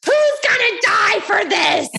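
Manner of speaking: high, loud, slow hammed-up rage; mock furious shout. Wife raging over broken dryer, loud mock shout. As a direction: bellow for vengeance, playfully over the top. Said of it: "And I'm like".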